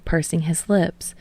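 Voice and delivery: plain reading voice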